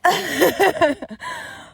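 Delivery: high rising laugh at herself; embarrassed laugh. Real, not acted. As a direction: laugh off the pain. Laughing at her own mishap.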